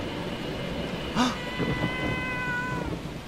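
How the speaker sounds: high, breathy startled gasp; sharp gasp. Real, not acted. Streamer sees something alarming and gasps sharply. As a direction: gasp in real surprise.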